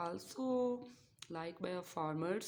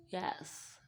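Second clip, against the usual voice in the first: ordinary talk, nothing marked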